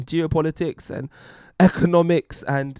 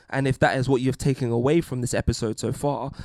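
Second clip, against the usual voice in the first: ordinary talk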